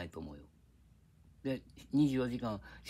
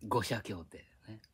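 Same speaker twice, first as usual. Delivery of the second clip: normal talking voice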